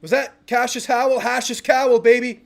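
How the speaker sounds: high, loud hype; excited celebration. Real, not acted. Celebrating the sack.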